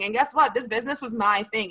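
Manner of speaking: ordinary delivery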